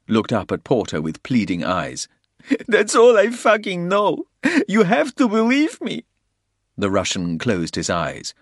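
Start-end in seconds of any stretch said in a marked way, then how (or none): 2.7-6.0 high, loud, desperate begging. A narrator's reading. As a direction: beg to be believed. Man begs with pleading eyes.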